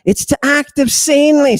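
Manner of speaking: high, lightly voiced dead Victorian; a hint of a prim Victorian gentleman; Alfred's voice. Alfred the dead Victorian answers, high and prim. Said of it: "says Alfred".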